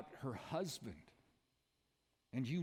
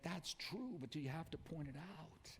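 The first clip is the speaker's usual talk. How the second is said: normal talking voice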